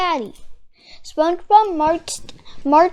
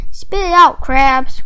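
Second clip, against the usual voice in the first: louder, pressing cry; urgent cry; 8 dB louder than usual. Acted. SpongeBob urging Mister Krabs to speak, loud cry. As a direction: push him to spit it out. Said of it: "cried SpongeBob".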